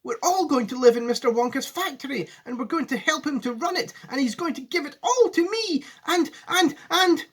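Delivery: put-on high, breathless excited boy; excited child. A narrator's reading. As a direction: tumble the news out in a rush. Charlie excited, tumbling words.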